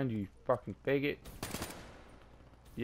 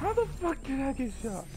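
baffled outcry; 10 semitones higher than usual. Real, not acted. Baffled after being shot.

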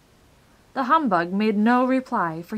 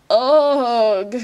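higher, drawn-out "ugh" gasp; loud gasped groan; 4 semitones higher, 11 dB louder than usual. A narrator's reading. Bug suddenly realizing his hunger, loud gasped groan. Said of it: "gasped the bug".

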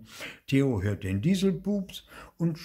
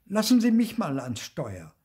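louder, higher-pitched impatient demand; impatient mother; 7 semitones higher, 5 dB louder than usual. A narrator's reading. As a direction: cut in high and loud, taking over. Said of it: "gar nicht schnell genug gehen".

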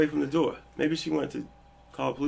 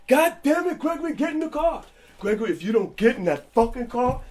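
high, loud furious shouting; angry yell; 10 semitones higher, 5 dB louder than usual. Retold or imitated. Dad yelling at his son to get in the car, angry. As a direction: order the kid into the car, enraged.

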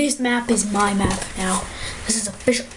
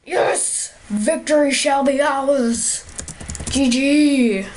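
higher, grand victory proclamation; triumphant cheer; 4 semitones higher, much slower than usual. Real, not acted. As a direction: proclaim victory like a hammy general. Celebrating a win.

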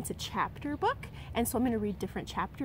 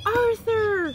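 much higher and louder call of a name; yell; 11 semitones higher, 8 dB louder than usual. Acted. Stanley calling Arthur for help, high loud yell. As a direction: shout for your brother across the hill. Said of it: "yelled Stanley".

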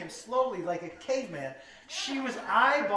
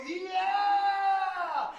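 crowd cheer; 8 semitones higher than usual. Retold or imitated. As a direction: cheer like the whole room. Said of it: "And we go".

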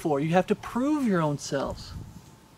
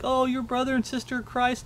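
lifted, softer mocking tone; mock tearful voice; 5 semitones higher, 5 dB quieter than usual. Preacher mocking a teary video believer, lifted, soft mock voice. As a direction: mimic a teary fake believer, up high and soft.

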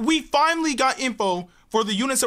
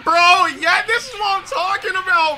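hyped; 6 semitones higher than usual. Real, not acted. Hype reaction.

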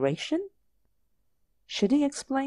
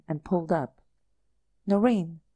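usual speaking voice

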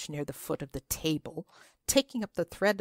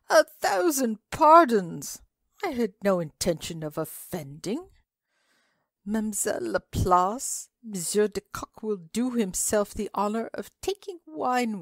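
higher, louder gallant apology; a flustered Frenchman begging pardon; de Kock's apology; 5 semitones higher, 6 dB louder than usual. Acted. Monsieur de Kock apologizes to the old lady, high and gallant.

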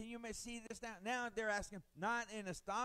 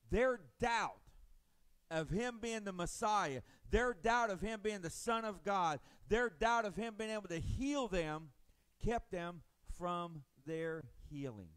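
loud, driving sermon voice; impassioned preaching; 2 semitones higher, 6 dB louder than usual. Real, not acted. Pastor preaching to the church, loud and building. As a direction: preach it hard to the congregation.

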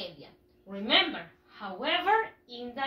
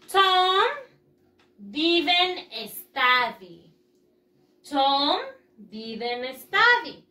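slow dictation; 3 dB louder, much slower than usual. Shown as a demonstration. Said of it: "reading model sentence".

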